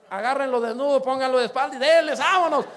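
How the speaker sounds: loud, high, hammed-up command; mock shouted orders. Preacher acting out a rough welcome with mock shouted orders. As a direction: bark it like a rowdy welcome crew.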